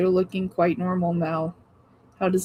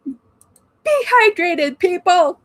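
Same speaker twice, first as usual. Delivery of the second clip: high, loud shouted reminder; yelled; 9 semitones higher, 7 dB louder, slower than usual. Real, not acted. Host yelling a hydration reminder to her viewers. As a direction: yell it at the whole chat. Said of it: "I don't know why I just yelled".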